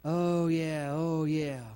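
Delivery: low, musing agreement; lightly acted brooding assent; entertaining the doubt. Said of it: "we start entertaining that".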